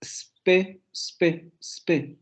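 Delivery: first syllable spe- isolated and repeated, S leading; syllable starting on S. Shown as a demonstration. Teacher isolating the first English syllable, S-initial spe-. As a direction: say spe- alone, straight from the S. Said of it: "la primera sílaba empieza con la S".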